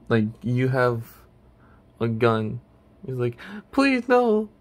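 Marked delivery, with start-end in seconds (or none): 3.7-4.5 high-pitched little plea; small character pleading for mercy, pitched way up; Chip. Acted. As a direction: beg in a squeaky high voice. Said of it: "in his Chip voice".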